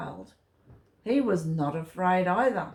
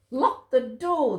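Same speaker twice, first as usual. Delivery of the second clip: raised voice, higher and louder; urgent shout to lock the door; 6 semitones higher, 4 dB louder than usual. A narrator's reading. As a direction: call it out in alarm. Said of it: "they shouted".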